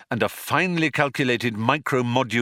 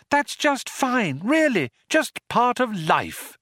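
relentlessly bright reassurance, pitched up; lilting. A narrator's reading. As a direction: soothe in an exaggerated lilt. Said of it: "lilted Trillian".

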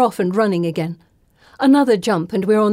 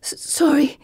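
higher-pitched, stammered wheeze; wheezing; 6 semitones higher than usual. A narrator's reading. Heroine apologizing after the fall, wheezing. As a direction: squeeze out a winded apology. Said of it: "I wheeze".

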